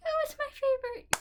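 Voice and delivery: high, loud mocking gush; mocking fan voice. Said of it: "Some people are like".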